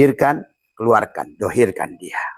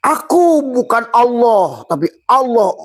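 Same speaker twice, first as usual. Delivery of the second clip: voicing the listener; 13 semitones higher, 5 dB louder than usual. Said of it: "Kata kamu". (suara tinggi dan lambat menirukan jamaah)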